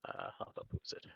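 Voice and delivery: whispered note-taking. Real, not acted. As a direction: whisper it while writing a note. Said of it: "Me taking notes".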